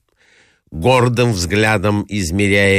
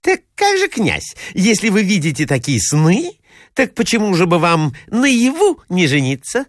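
high-pitched, leading question to the prince; coaxing society lady; 11 semitones higher than usual. A narrator's reading. Lady questioning the prince about his dreams, high and coaxing. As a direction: put on a lady's high voice.

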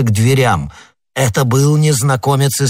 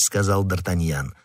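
usual speaking voice

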